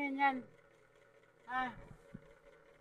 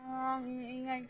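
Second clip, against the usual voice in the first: ordinary talk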